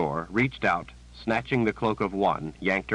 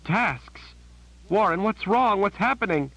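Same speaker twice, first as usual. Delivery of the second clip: panicked; 13 semitones higher, 4 dB louder than usual. A narrator's reading. Verna panicking over Warren.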